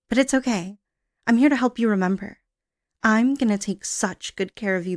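normal talking voice

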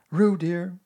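high, loud motherly reproach; reproachful. A narrator's reading. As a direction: chide the little one fondly. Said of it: "said Kanga reproachfully".

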